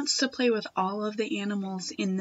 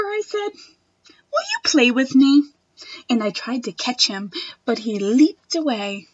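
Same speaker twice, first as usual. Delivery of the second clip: put-on expressive read-aloud for children; reading a picture book to a class; storybook reading; 5 dB louder than usual. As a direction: read it like story time for little kids.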